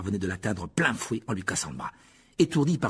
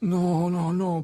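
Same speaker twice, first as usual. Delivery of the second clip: higher, drawn protest; 8 semitones higher, 4 dB louder, much slower than usual. A narrator's reading. Harry refusing Lockhart's healing, protesting. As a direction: object in a raised, pleading pitch. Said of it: "protesta Harry".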